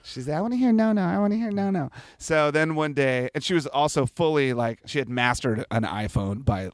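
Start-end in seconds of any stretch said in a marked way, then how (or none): 0.4-1.9 put-on high-pitched toddler plea; little-kid voice, pitched up; imitated two-year-old. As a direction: pipe up like an insistent small child. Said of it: "my two-year-old would sing the Rehab song".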